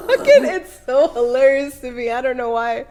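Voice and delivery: talking through real laughter; helpless giggly delivery; laughing. Real, not acted. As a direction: crack up at your own joke. Cracks up at own whiny voice.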